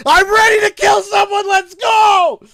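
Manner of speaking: high, loud furious yell; raging shout. Retold or imitated. Yelling after the fleeing kid, full of rage. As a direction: scream it with adrenaline.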